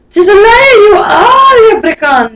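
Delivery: loud high babbled accusation; high loud babbling outburst. Acted. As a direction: blurt it out at the liar. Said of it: "babbled Ninny Nanny".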